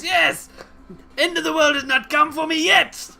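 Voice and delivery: high, loud triumphant proclamation; exuberant eschatologist. Acted. Friend answers Dimitri's worry, loud high jubilant cry.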